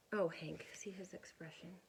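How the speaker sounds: ordinary delivery, nothing marked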